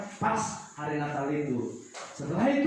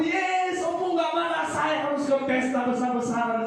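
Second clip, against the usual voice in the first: put-on excited child; 10 semitones higher, 3 dB louder than usual. Child rejoices, may go party.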